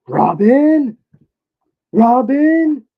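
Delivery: high questioning shout of Robin; wrong call. Pretend. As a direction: yell Robin? high and rising like a question, each falling at the end. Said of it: "that don't sound right".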